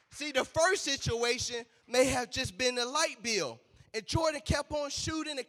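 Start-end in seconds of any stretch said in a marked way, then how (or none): none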